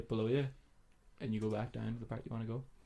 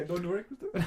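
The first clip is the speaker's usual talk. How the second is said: put-on higher South African accent slipping into Indian; failed South African impression; 8 semitones higher than usual. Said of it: "Indian there now".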